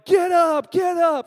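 high, loud yelled call; teammates yelling. Retold or imitated. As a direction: yell it across the field at a lazy teammate. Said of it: "they're yelling at me".

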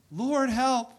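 cry for help. Acted. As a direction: cry out like someone drowning in trouble.

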